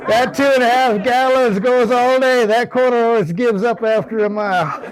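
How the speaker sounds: amused, laughing delivery; laughing while talking. Real, not acted. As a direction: keep laughing as you get the punchline out. Laughing through his own joke.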